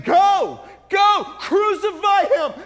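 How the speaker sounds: bay for crucifixion like a mob; the mob screams Go, crucify him; crowd screaming. Acted. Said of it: "they're screaming".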